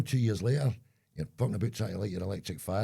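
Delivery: usual speaking voice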